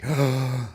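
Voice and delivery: sharp acted intake of breath; frightened gasp. Worm sees the crow diving, scared gasp. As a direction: gasp as if suddenly alarmed. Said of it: "a little bit scared this".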